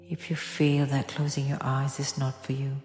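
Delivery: normal talking voice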